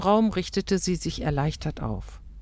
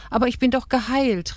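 high-pitched protest; raised pitch, pleading to be understood; insistent cry; 9 semitones higher, 3 dB louder than usual. A narrator's reading. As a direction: call it out, desperate to be believed. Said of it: "rief Rahel".

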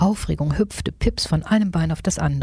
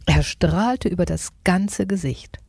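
ordinary delivery, nothing marked

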